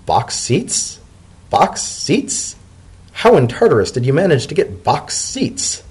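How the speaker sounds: high, breathy disbelief; stunned. Acted. Leafy hears about the box seats, stunned. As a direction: repeat it, unable to believe it.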